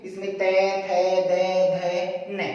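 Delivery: तय to ने sounded out one by one; demonstrating sounds. Shown as a demonstration. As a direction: recite the row slowly for students. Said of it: "तय वर्ग".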